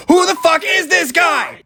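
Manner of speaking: put-on high, slow, loud cranky old-man shout; bewildered old geezer. Player mocking himself as an old man, high slow shout. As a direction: yell it like a confused grandpa. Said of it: "I'm over here like geriatrics just going".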